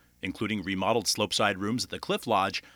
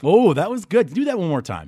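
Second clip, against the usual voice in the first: delighted; 7 semitones higher, 8 dB louder than usual. Real, not acted. Host delighted by accent.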